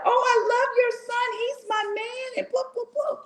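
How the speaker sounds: high, gushing mock teen voice; gushing girls. Mother mimics girls swooning over her son, high. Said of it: "they were like".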